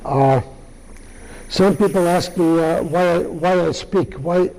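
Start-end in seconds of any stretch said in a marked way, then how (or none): none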